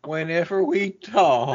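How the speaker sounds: put-on lightly slow Southern accent; mock Southern accent. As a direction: tease her with an old Southern accent. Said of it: "full-blown Southern drawl".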